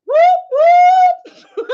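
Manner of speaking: high, loud whooping cheer; celebratory whoop. Real, not acted. Streamer celebrating a one dollar shirt, high gleeful whoops.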